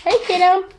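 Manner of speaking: high cooing greeting; talking to a dog. Real, not acted. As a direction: call the dog sweetly up high.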